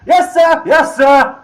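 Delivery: high, very loud "Yes, sir!"; two helpers shouting back. Acted. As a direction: shout back obediently to the boss. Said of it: "like, two voices go".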